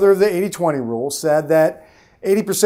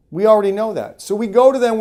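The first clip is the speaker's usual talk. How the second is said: ordinary delivery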